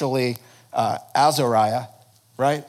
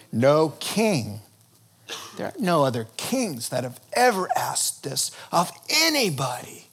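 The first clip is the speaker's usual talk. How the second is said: usual speaking voice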